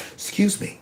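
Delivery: hushed, humble ask; whisper-soft, asking meekly. Retold or imitated. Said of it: "in my most humble voice".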